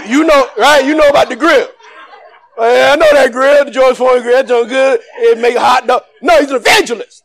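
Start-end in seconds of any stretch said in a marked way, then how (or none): 2.6-6.0 mock churchgoer. Mocking congregants about the grill.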